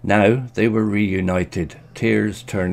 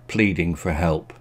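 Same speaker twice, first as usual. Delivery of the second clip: ordinary delivery, nothing marked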